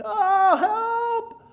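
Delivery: high, loud panicked yelp; falling cry for help. Acted. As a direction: scream as you tumble. Man falls down a hill.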